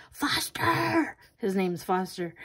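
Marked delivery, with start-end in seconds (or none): 0.1-1.2 high, panicked scream of the name; screaming the dog's name. Retold or imitated. Owner screams at dog holding bird. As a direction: shriek it to stop the dog. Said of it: "I screamed my head off".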